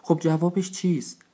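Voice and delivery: high, drawn-out asking; eager group question. A narrator's reading. Elders all ask the leader for his answer, eager high question. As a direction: ask slowly, pitched well up. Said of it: "بزرگان همه با هم و همزمان پرسیدن".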